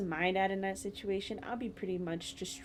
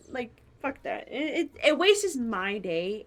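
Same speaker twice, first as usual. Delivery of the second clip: ordinary delivery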